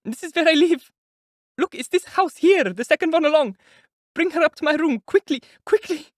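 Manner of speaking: high, loud hurried directions; urgent pleading. A narrator's reading. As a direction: rush them along, frantic. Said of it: "she said, rushing".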